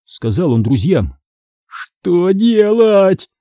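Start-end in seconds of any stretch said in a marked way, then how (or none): 1.7-3.3 high, drawn-out moan. A narrator's reading. Injured Michael asking trapped friends for help, moaning. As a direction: moan it slowly at a high pitch. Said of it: "простонал Майкл".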